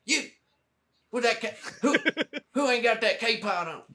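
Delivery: high Texan twang, breaking into laughs; Texan sergeant impression. First sergeant calling out who lacks a K-Pod, in a high Texan twang. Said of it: "Your impression of him".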